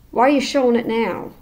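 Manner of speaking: usual speaking voice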